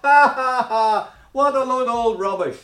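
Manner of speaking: loud, high mocking laughter; mocking scoffer. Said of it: "they mocked".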